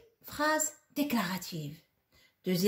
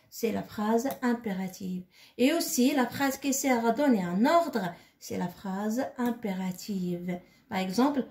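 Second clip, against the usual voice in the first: ordinary talk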